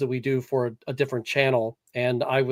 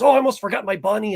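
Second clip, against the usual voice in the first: higher, quick little exclamation; lightly voiced daughter; 7 semitones higher, 4 dB louder, faster than usual. Dad retelling his daughter's video line, lightly imitated. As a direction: imitate a child suddenly remembering, lightly.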